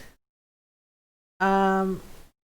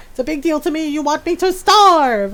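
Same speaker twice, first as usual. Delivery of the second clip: high, dramatic cat complaint; protesting cat; 6 semitones higher, 6 dB louder than usual. Retold or imitated. Hungry cat to owner over late dinner, melodramatic. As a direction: wail it, stretching out the last word louder. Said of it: "he meows at me".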